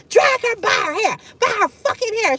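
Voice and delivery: hammed-up threat to drag her by her hair; mock furious threat. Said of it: "She was so mad".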